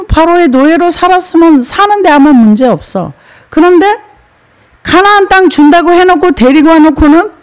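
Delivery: rising indignant whine of the people; grumbling Israelites, pitch rising in protest; mock complaint. Preacher voicing grumbling Israelites.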